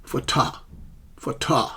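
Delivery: "photo" cut out as pho-TAH; isolated syllables pho-TAH; stressed second syllable. Shown as a demonstration. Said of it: "the first syllable pra is stressed".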